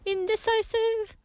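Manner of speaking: high-pitched playful dismay; mock shock. Host reacting to a listener's indecision, playful mock shock.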